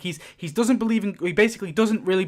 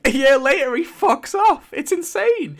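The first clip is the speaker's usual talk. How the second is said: higher, louder outrage; outraged disbelief; 7 semitones higher, 4 dB louder than usual. Real, not acted. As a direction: hammer home the absurdity. Repeated punchline, outraged.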